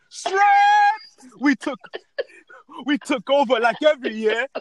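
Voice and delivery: high, loud boastful crowd voice; Jamaicans bragging. Retold or imitated. Mocking Jamaican fans boasting at carnival, high loud brag. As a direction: shout the claim like a proud Jamaican reveller. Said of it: "you see the Jamaicans are like".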